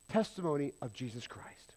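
whispered low and soft; hushed whisper. Acted. Preacher telling the sinner about Jesus, soft whisper. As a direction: breathe it like a secret treasure.

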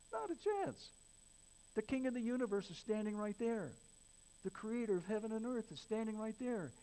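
high-pitched, forceful preacher's proclamation; impassioned preaching. Real, not acted.

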